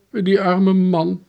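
high, slow sympathy; drawn-out motherly pity. Acted. Mother pities the arrested man.

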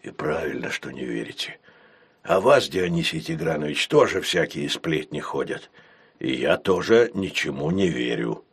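calm, low, unhurried retort; parry it calmly, deep and even. A narrator's reading. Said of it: "спокойно парировал хозяин".

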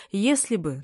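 plain reading voice

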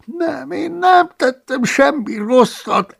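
protesting. Acted. The cellarius protesting innocence to Paulus, whining. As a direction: protest your innocence in a high whine.

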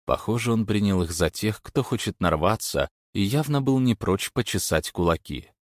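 usual speaking voice

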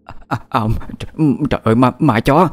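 frightened stammer. Acted. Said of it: "sợ hãi không nói trọn câu".

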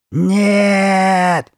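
long, high, loud cry; hoarse scream. A narrator's reading. Girl refusing her new face, hoarse scream. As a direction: scream it out in horror. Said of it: "снова закричала Карли Бет своим новым сиплым голосом".

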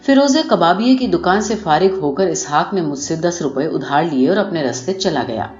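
plain reading voice

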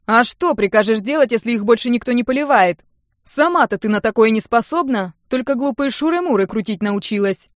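loud, cross counter-attack; angry retort. A narrator's reading. Uncle hits back at teasing niece, angry. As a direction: fire back, properly annoyed. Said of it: "Алексей не на шутку рассердился".

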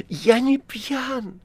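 hoarse, slow, high plea. A narrator's reading. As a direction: croak it out hoarsely. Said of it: "хрипло ответил Стёпа". (хриплым голосом)

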